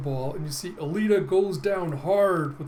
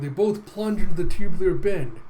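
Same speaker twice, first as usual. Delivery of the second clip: lightly voiced race announcer, louder; 3 semitones higher, 9 dB louder than usual. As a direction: call the race with a touch of volume. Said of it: "you hear the announcer going".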